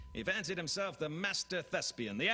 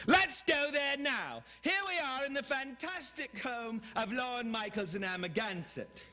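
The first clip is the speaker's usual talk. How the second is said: oversell it like a gushing tour host; Robin Leach; 10 semitones higher than usual. Retold or imitated. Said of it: "guy who screams, this, uh, Robin Leach".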